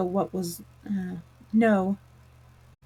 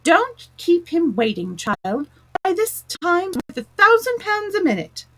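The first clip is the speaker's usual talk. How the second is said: chorus of voices; 9 semitones higher than usual. A narrator's reading. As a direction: pitch it high like a crowd chanting together. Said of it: "a great many voices all said together".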